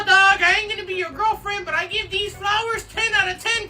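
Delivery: ordinary talk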